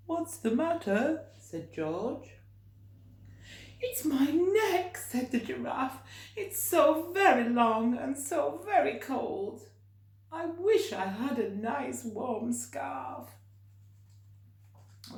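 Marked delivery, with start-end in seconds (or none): 0.1-1.3 high, concerned question; kind giant asking. Acted. Giant George asking the sad giraffe what's wrong, concerned. 3.8-13.3 sad giraffe. Acted. Sad giraffe complaining to George about its cold neck. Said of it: "sniffing sadly".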